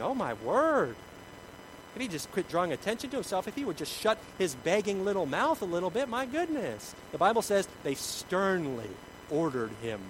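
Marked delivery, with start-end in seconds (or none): 0.0-6.9 higher, swooping fussy complaint; mock scandalized. Onlookers grumbling about the begging man, mock scandalized. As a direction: play the mortified onlooker. Acts embarrassed crowd complaining.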